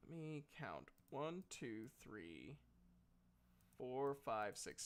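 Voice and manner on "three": normal talking voice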